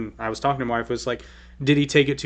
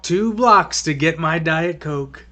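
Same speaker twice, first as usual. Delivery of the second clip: mock spoiled whiner; 6 semitones higher, 5 dB louder than usual. Co-host mocks the easy-life president, whining. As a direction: whine like a pampered brat over nothing.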